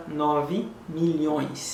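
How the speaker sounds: "nòv milhões" said slowly and clearly; slow model phrase. Shown as a demonstration. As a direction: draw out "nòv milhões" for learners. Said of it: "w ap di konsa". (di "nòv milhões" dousman)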